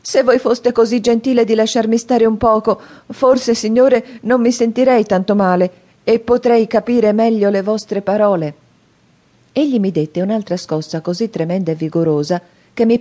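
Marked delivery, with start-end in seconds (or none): 0.0-8.6 put-on higher, louder pleading child; a scared boy begging to be let go; frightened plea. A narrator's reading. Terrified boy begging the convict to stop shaking him, higher. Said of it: "Io ero terribilmente spaventato".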